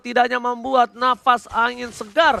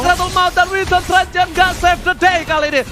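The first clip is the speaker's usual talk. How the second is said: loud, higher shouted hype call; fighting game announcer; 8 semitones higher, 6 dB louder than usual. Real, not acted. Hype call of base push.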